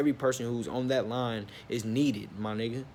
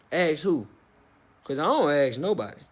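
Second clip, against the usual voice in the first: defiant retort; 3 semitones higher, 4 dB louder than usual. Retold or imitated. Acted defiant reply to manager.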